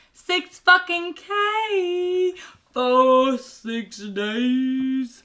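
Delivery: slow, sing-out mock excitement; gleeful bragging. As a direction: stretch the big news into a crow. Telling best friend about the windfall.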